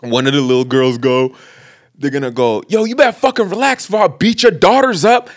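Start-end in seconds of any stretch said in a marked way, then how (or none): 2.7-5.3 high-pitched imitation of a warning; mock threat. Moviegoer warns another off, mock threatening. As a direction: act out a threat with raised pitch. Threat to beat daughters, retold.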